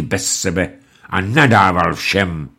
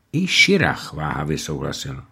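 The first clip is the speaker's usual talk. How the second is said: ordinary delivery, nothing marked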